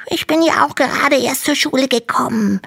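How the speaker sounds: very high, small dragon voice; little dragon voice. Acted. Little dragon complains to Hanno in a tiny high voice. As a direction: sound like a tiny, timid baby dragon.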